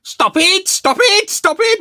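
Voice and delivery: very high, loud shriek; mock shrieking. Fan quoting Moss being groped, shrieking. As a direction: scream it like a flustered nerd. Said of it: "Morris Moss is like".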